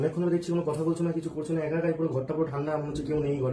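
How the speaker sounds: concerned probing. Acted. Husband asking silent wife what happened, subdued concern. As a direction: ask quietly what is wrong, pitched low.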